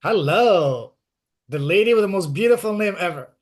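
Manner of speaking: ordinary delivery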